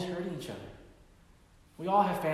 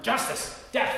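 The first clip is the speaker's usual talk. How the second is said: angry shout; 9 semitones higher, 5 dB louder, slower than usual. Retold or imitated. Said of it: "And his heart burned".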